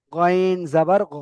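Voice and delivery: গইন জাবার গ slowly, closing on short গ; slow drilled reading. Shown as a demonstration. (ধীরে গইন জাবার গ, শেষে ছোট গ)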